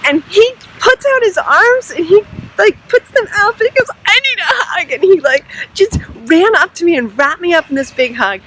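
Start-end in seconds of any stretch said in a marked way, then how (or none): 4.1-4.9 high, gushing imitation of his plea; retold emotional plea. Moved worker asking the vlogger, retold emotional plea. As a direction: mimic him overcome, pitched way up.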